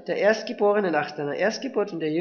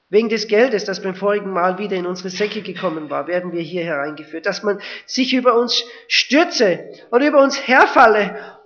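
louder, rising into dread; fearful brothers; 2 semitones higher, 8 dB louder than usual. Acted. Brothers fearing slavery in Egypt, rising alarm. Brothers fear enslavement, quote read.

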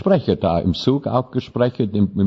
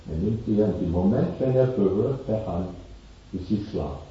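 normal talking voice

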